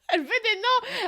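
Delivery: high, loud laughing protest. Real, not acted. Teased for names, laughs. (rire aigu et fort en protestant)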